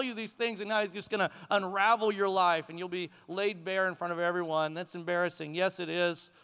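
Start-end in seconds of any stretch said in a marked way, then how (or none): none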